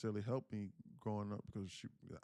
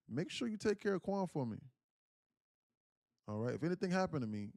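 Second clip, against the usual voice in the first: higher-pitched pleading grandmother; imitated grandmother; 7 semitones higher than usual. Grandmother asking her son to look after grandchild, imitated plea. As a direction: voice grandma's earnest request, pitched up.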